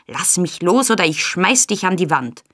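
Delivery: usual speaking voice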